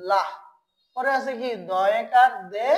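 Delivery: ordinary talk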